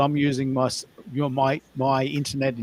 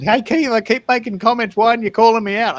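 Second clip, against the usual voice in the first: quoted commenter; 9 semitones higher, 8 dB louder than usual. Retold or imitated. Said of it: "Several people on Substack have mentioned".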